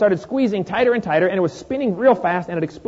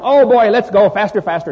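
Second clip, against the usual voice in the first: mock excited kid yell, louder; kids hollering; 3 semitones higher, 6 dB louder than usual. Kids on the merry-go-round egging the pushers on, mock excited yell. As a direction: playfully imitate thrilled kids shouting. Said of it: "The kids are screaming and hollering".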